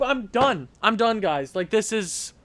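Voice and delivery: normal talking voice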